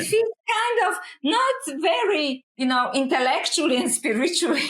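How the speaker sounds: laughing. Real, not acted. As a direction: laugh while you say it. Laughing at spaceship comparison joke.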